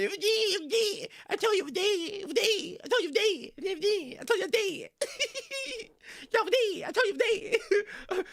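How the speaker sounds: high squeaky whining repeat; singsong high-pitched nagging, half laughing; mocking whine. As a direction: mimic a pestering viewer in a high whine. Mocking chat's insistence, repeated.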